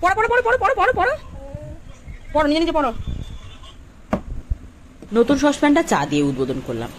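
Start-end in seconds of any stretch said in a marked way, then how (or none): 0.0-2.9 very high sing-song coaxing; sing-song urging. Real, not acted. Urging toddler to read.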